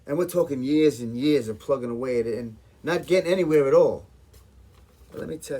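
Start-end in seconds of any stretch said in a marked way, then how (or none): 0.1-4.1 loud rant building to a peak; frustrated outburst. Acted. Caterer on years of plugging away, frustrated. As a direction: get louder right through to the last words. Venting about years of fruitless work.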